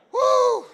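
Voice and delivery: loud, high "woo"; sudden celebratory whoop. Real, not acted. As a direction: let out a piercing whoop. Preacher whoops after his punchline.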